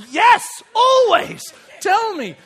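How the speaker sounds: loud, high, drawn-out urging; emphatic eagerness. Retold or imitated. Dad to eldest daughter offering a secret, eager. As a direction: demand the secret with exaggerated eagerness.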